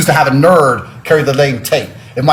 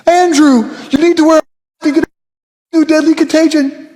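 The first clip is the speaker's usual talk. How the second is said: high mock wife voice, pitched way up; mock nagging wives. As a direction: squeak it like a fretting wife. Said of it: "wives voiced in story".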